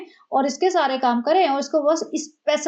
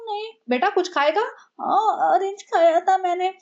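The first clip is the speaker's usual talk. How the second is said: higher, put-on whimper of a pampered wife; mock whiny exchange; 6 semitones higher than usual. Mocking the pregnant wife whining to her husband about food. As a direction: whine it as pure drama. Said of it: "इसने इतनी नौटंकी की".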